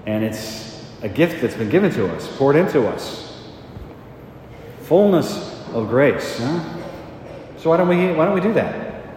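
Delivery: full-force preaching, high and loud; fervent preaching. Acted. Priest urging his congregation to trust the Holy Spirit, fervent. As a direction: rouse the congregation to act.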